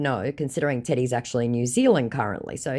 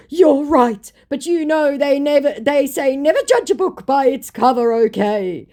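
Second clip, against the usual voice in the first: scammer voice; 13 semitones higher, 8 dB louder than usual. Retold or imitated. Voicing indignant scammer.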